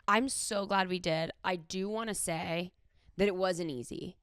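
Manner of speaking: usual speaking voice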